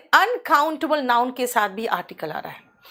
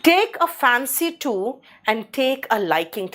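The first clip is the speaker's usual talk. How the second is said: slow read idioms; much slower than usual. Shown as a demonstration. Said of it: "teacher reads idioms".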